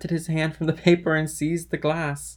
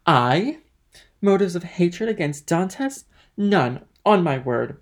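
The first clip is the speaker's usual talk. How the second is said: loud, higher, affronted questioning; indignant denial; 5 semitones higher, 5 dB louder, slower than usual. Acted. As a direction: protest your innocence with emphasis. Danglars indignantly denies hating Dantès.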